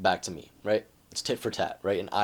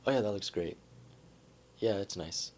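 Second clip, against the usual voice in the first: soft, unenthusiastic approval; mildly indifferent husband; lukewarm praise; 6 dB quieter than usual. Acted. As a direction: toss off a polite compliment without enthusiasm.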